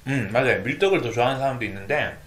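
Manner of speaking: talking between bites; mouth full, chewing. Real, not acted. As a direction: food in cheek. Seen 0: cheeks bulge and jaw works over food while he speaks.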